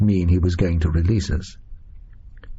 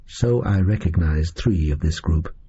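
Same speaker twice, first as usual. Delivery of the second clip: usual speaking voice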